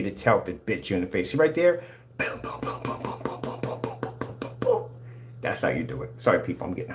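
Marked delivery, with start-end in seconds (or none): 2.2-4.9 rapid soft unvoiced "boom" noises; whispered machine-gun booms. Real, not acted. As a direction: puff out a string of quiet booms. Punctuating a demo with mimicked booms.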